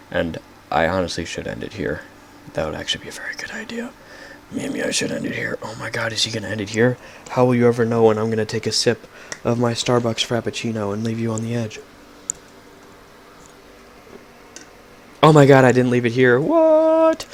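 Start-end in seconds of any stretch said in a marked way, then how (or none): 2.5-5.6 high breathy stage whisper. Pretend. Mock whispered aside to self. 5.6-17.3 high, hammed-up gasping excitement; dramatic mock suspense. Mock suspense about ending podcast.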